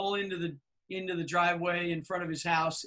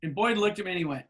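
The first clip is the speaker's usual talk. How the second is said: ordinary talk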